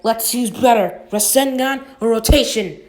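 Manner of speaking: excited challenge. Acted. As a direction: throw the question at him, pumped. Naruto challenging Neji mid-fight.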